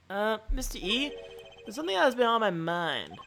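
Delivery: hesitant younger sidekick. Acted. Reader voices Eddie in dialogue.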